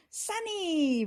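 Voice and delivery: high, bright shout of a name; joyful shout. A narrator's reading. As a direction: call out her sister's name with joy. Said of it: "Ruby shouted".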